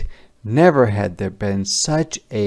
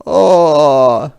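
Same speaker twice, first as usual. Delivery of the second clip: higher, louder wistful "Ah"; a longing soldier's sigh; soldiers' sigh; 5 semitones higher, 10 dB louder than usual. A narrator's reading. Soldier sighing over the pot, higher and louder. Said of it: "said the soldiers".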